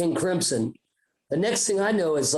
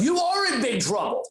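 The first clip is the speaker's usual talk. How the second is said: high-pitched mock parental warning; retold indignant scolding; 7 semitones higher than usual. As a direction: lay down the law like an angry parent. Parents threaten the boys.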